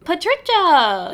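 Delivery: long drawn-out cry; dramatic wail. Real, not acted. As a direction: wail the name slowly. Crying out to the plant.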